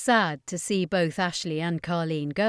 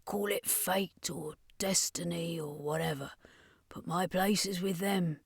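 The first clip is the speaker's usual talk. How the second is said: hushed, almost whispered; quiet, resigned whisper; softly; 9 dB quieter than usual. A narrator's reading. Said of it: "Carlene said softly".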